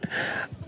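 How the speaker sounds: soft, breathy little laugh; short, quiet chuckle at your own joke; real chuckle. Real, not acted. Laughs after his own joke.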